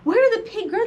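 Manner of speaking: animated storybook exclamation, pitch jumping up; crying out. Acted. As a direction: cry the question in surprise. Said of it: "the animals cried".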